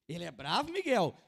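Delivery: loud retold question, jumping up on the name; booming mock-incredulous dad; questioning father. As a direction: imitate a dad loudly checking with his son. Voicing the father questioning his son.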